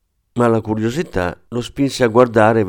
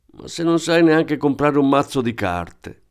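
higher-pitched, mildly resentful retort; spiteful outburst; 6 semitones higher than usual. A narrator's reading. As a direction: throw it back at him with some spite. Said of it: "sbottò la tedesca in tono astioso". (con tono astioso)

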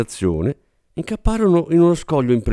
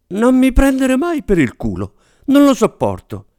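much higher, tense plea; shrill warning; 12 semitones higher, 4 dB louder than usual. Acted. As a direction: warn her not to joke, voice cracking. Warning agent not to joke.

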